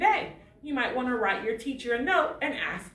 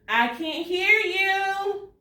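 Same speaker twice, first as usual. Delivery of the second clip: coaxing teacher; 9 semitones higher, much slower than usual. Acted. Teacher coaxing class to answer.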